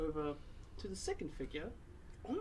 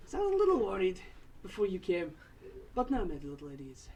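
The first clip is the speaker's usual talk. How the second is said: higher and louder dwarf voice; old dwarf woman; 3 semitones higher, 6 dB louder than usual. Acted. Borla asking about the companions, hearty dwarf voice.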